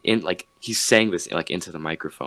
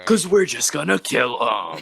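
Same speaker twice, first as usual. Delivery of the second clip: louder, higher, drawn-out mock declaration; emphatic punchline; 6 semitones higher, 5 dB louder than usual. Retelling Sam Hyde's punchline to friends, emphatic imitation. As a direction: throw the punchline out hard and slowly. Acting out the comedian's shock punchline.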